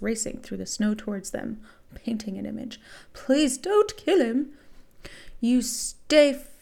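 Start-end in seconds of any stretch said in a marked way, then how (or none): 3.1-4.5 high, loud, slow begging; desperate plea. A narrator's reading. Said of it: "a loud, desperate voice screamed out". 5.4-6.5 slow, loud gruff attacker; gruff shout. A narrator's reading. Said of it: "shouted the gruff male voice".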